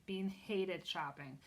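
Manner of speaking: plain reading voice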